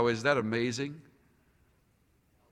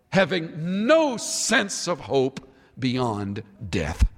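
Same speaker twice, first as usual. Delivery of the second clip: fervent preaching; 4 dB louder than usual. Acted. Preaching at full force.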